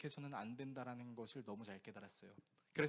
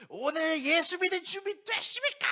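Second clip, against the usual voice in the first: loud, high rally call; revival leader; 13 semitones higher, 14 dB louder than usual. Retold or imitated. Worship leader rousing youth at a rally, loud hype. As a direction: whip up the crowd. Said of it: "막".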